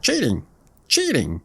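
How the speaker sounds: lightly voiced duck calling foul; duck crying. A narrator's reading. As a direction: complain as a lame duck waddling off.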